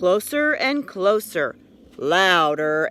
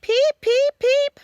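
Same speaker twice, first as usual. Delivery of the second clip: very high, chirpy little peeps; frog peeping; 4 dB louder than usual. A narrator's reading. Little frog calling through the woods, high chirpy peeps. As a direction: pipe it like a tiny spring frog.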